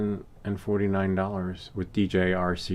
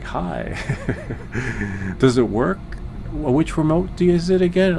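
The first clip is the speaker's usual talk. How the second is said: mock clueless buyer; 6 semitones higher than usual. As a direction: play the confused buyer, pitched up. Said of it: "And like".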